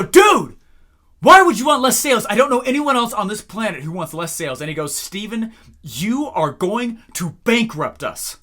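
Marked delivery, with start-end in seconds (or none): 0.0-4.6 loud, high exasperated rant; fed up with the CEO, shouting back; fed-up outburst. Retold or imitated. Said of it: "I was fed up". 4.9-8.4 desperate plea. Retold or imitated. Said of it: "in desperate frenzy".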